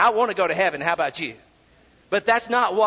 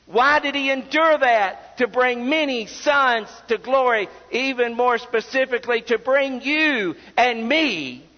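higher-pitched, driving sermon delivery; fervent pulpit voice; impassioned preaching; 4 semitones higher than usual. Real, not acted. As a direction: preach it with rising urgency, pressing each point home. Preaches at full force.

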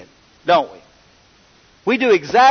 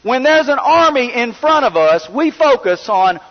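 higher, building sermon appeal; rising preaching; 6 semitones higher than usual. Acted. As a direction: press the point harder to the church.

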